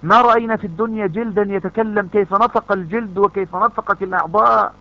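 preacher's passionate climax; fervent preaching. Acted. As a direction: drive each line harder at the crowd.

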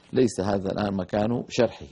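ordinary talk

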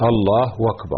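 "الله أكبر" sounded out as Allahu-wakbar; hamza turned to waw. Shown as a demonstration. Sheikh repeating the questioned takbir, with w for hamza. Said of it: "فيستعيض عن الهمزة بالواو".

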